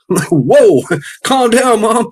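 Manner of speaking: high, loud laughing words; laughing at his mom. Real, not acted. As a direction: laugh through it. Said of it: "laughing at mom".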